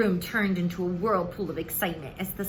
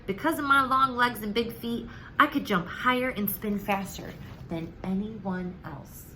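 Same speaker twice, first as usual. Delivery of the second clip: ordinary delivery, nothing marked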